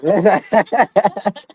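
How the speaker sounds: slow, drawn-out words broken by laughter; a mock scolding that dissolves into giggles; laughing through it. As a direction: stretch it out while cracking up. Laughing while repeating the phrase.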